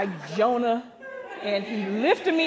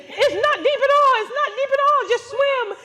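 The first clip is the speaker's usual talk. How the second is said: high, loud breezy guide voice; mock guide; 6 dB louder than usual. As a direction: toss it off like a cheerful guide. Guide reassuring her, quoted.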